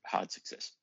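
whispered under the breath. Real, not acted. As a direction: murmur it to yourself in a whisper. Player repeats result to himself.

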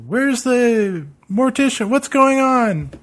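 panicked question. Acted. Mourners panicking at the funeral.

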